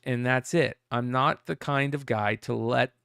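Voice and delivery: normal talking voice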